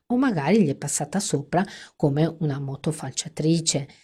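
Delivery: plain reading voice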